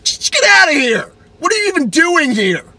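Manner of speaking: high, loud yelling at the driver; outraged high-pitched yelling; angry shout. Retold or imitated. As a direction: scream him off the road. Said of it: "I, like, do this whole".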